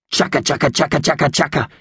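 high, rattling bird chatter; magpie chatter. Acted. Magpies chatter while waiting.

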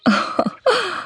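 high giggling laugh; light laugh. A narrator's reading. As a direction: burst into a giggle. Lily laughs at pixies.

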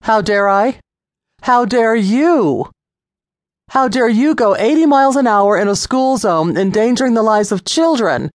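angry lecture. A narrator's reading. Officer scolding Lisa for endangering children, angry. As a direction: unload on her, stern. Officer has had enough.

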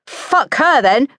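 slow, high, emphatic comeback; defiant retort. A narrator's reading. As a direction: throw it back at a sister. Mother's retort in acted quarrel.

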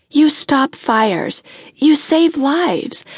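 slow, deliberate praise; admiring emphasis. A narrator's reading. Woman telling her firefighter why she loves him, slow and admiring. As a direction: spell out each deed slowly.

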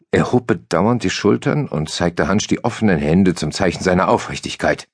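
ordinary delivery, nothing marked

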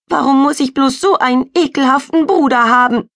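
high, loud tearful wail; sobbing. Acted. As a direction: sob it out into the pillow. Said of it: "schluchzt sie".

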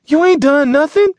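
very high, loud shriek; screaming protest. Acted. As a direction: scream it insistently at the driver. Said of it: "Sassafras screamed again".

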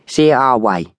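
put-on oily young tough, voice lifted; oily youth chiming in. A narrator's reading. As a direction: chime in as a pushy Americanized Cockney. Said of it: "chimed in the youth with the oily hair".

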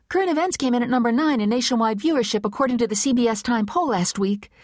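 fast and high, rushing; quick. A narrator's reading. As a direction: rush it out nervously. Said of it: "She said quickly".

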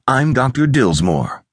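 high, slow feminine voice; caressing voice. A narrator's reading. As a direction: introduce yourself with a gentle caress. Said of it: "her voice like the caress".

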